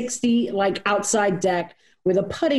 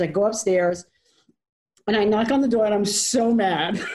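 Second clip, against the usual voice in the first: normal talking voice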